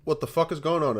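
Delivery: ordinary talk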